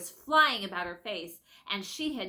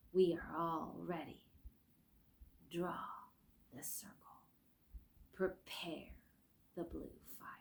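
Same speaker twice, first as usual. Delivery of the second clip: gray voice whisper; 2 semitones lower, 10 dB quieter than usual. Acted. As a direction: breathe it out like a cold spirit. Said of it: "said the gray voice".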